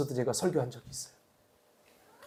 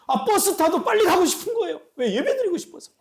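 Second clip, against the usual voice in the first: eager excitement; 12 semitones higher than usual. Real, not acted. Eager longing for worship.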